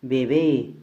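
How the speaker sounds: "Bebé" with a loud, held BÉ; final-syllable stress. Shown as a demonstration. Teacher shows a word ending in E, loud stress on BÉ in "Bebé". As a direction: punch the last syllable of "Bebé". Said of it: "mayor fuerza de voz en la última sílaba".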